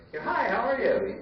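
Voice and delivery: high, sing-song fake-friendly hello; mock cheery greeting. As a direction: play the phony-nice party guest. Mocking fake-friendly dinner guest.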